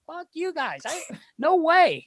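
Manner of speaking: very high-pitched mock refusal; squeaky, indignant impression; Phil Kessel. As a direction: imitate a teammate digging in. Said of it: "And he's like".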